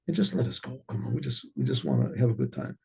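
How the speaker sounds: low, soft plea to be left be; quiet appeal. Retold or imitated. Father offering money to be let go, quietly appealing. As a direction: ask softly for a way out.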